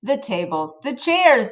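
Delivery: usual speaking voice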